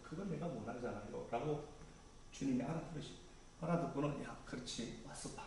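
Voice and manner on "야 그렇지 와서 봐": much softer, hushed and kind; Jesus softly inviting the disciples to come and see; gentle invitation. Retold or imitated.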